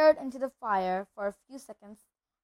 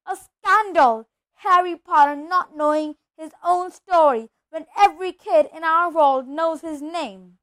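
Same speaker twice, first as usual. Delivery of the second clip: loud, raised, outraged bellow; angry roar; 4 semitones higher, 10 dB louder than usual. A narrator's reading. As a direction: thunder with indignation. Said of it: "jumping up so angrily".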